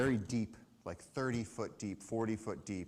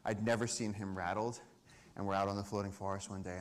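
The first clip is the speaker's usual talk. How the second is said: usual speaking voice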